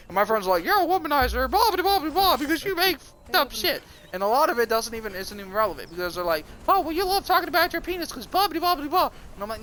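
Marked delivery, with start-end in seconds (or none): 0.6-3.8 high-pitched nagging blah-blah; mocking imitation. Imitating his friends' criticism. 6.7-9.1 high-pitched nagging blah-blah; mocking imitation. Imitating his friends' criticism.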